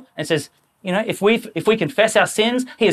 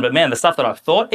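ordinary delivery

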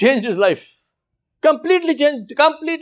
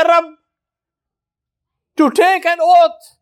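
overwhelmed awe; 7 semitones higher than usual. Retold or imitated. Repentant robber tells the imam of his awe, higher and slow. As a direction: marvel aloud that God swore for him.

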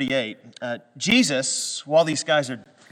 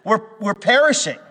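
higher, louder calling Master; urgent plea; 7 semitones higher, 5 dB louder than usual. Retold or imitated. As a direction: call out to him urgently, a little ham. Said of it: "they say".

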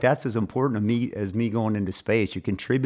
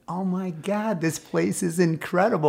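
awed; 8 semitones higher than usual. Retold or imitated. Astronaut awe in orbit.

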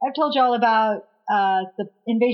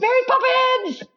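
excited squeal; 14 semitones higher than usual. Acted. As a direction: squeal the title with glee. Echoing the title, higher.

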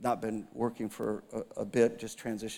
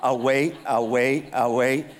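playful waiting; 8 dB louder, slower than usual. Real, not acted. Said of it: "I'll just wait".

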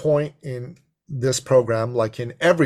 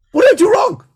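high, loud panicked outburst; hammed-up yelp of a worried man; mock panic; 12 dB louder, faster than usual. Man facing a crying woman, mock-panicked yell. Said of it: "like every man says when there's".

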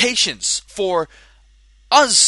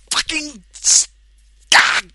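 getting pissed; 5 semitones higher, 4 dB louder than usual. Real, not acted. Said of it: "I'm just getting a little pissed".

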